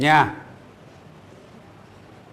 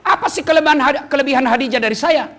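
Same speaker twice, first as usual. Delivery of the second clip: jealous Aisyah; 7 semitones higher than usual. Retold or imitated. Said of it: "Aisyah pun berkata".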